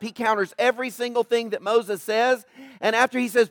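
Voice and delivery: normal talking voice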